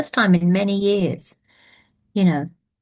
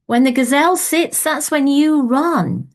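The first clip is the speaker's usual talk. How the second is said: high, raised voice calling across a field; shouting advice at the old man chasing the gazelle; 8 semitones higher than usual. Acted. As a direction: call it out to the old man far away. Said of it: "he calls out".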